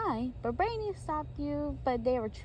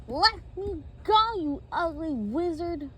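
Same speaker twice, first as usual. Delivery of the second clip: high, loud, slow demand; raised captive's protest; acted defiance; 6 semitones higher, 4 dB louder than usual. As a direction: demand it loudly, stretching the words. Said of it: "He said".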